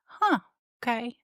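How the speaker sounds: high, mildly surprised huh, then an accepting okay; a mock startled yelp that settles; surprised shrug. Teen son taking the moving news calmly, lightly surprised. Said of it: "He's like".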